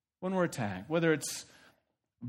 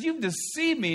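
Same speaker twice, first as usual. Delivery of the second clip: higher, indignant you've deceived me; disdainful; 10 semitones higher than usual. Acted. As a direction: sneer at the cheater. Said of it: "he's kind of disdainful".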